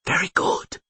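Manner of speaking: voiceless, hissing whisper; hissed. A narrator's reading. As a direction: praise her under your breath. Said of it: "hissed Kaylee".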